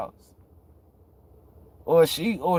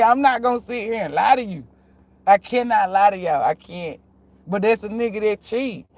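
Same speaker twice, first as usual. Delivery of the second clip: ordinary delivery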